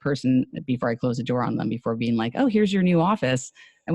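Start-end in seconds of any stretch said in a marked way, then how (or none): 2.3-3.5 higher-pitched improv office greeter; joking character. Retold or imitated. Commercial actor showing someone a new office, joking in character. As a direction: play the office welcome for a joke. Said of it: "jokingly in the character at the time".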